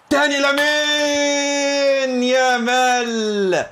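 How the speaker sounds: drawn-out, high, laughing cry of joy; excited cheer. Real, not acted. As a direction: stretch it out as he finally scores. Goal celebration in game.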